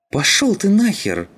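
very high, loud hysterical yell; hysterical outburst. A narrator's reading. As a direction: explode at your accuser, voice shooting up. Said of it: "истерично воскликнул эстонец". (истеричный высокий крик)

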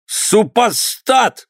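very high, drawn-out squealed insult; shouting. A narrator's reading. Held governor cursing the master, high-pitched shouting. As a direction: cry it out in helpless outrage. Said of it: "кричал воевода".

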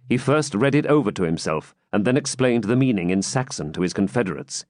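ordinary delivery

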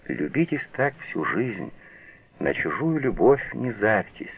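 ordinary delivery

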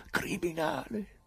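high and slow, holding back the volume. Acted. Pilate accusing the prisoner so the garden hears, high and slow. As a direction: start the accusation high but contained. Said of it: "Alzò ancora la voce".

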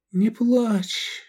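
gentle, weak reassurance; consoling. A narrator's reading. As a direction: soothe him in a faint girl's voice. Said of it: "утешила она его".

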